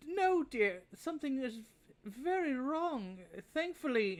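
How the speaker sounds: put-on high old lady voice, slowing on very wrong; old Maribel tells the party, high and troubled; old woman. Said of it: "she kind of goes".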